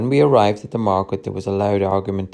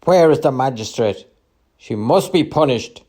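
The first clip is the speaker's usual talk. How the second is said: raised, louder voice of an accuser; angry demand; 5 semitones higher, 4 dB louder than usual. A narrator's reading. Market trader calling for the magistrate, angrily. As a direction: demand punishment in a loud, higher voice.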